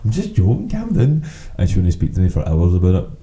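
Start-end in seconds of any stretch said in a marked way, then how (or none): 0.0-1.2 lightly pleading, pitched well up; mock placating. Brother retelling calming his sister after tripping her, placating. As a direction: retell a high-voiced backpedal to soothe someone.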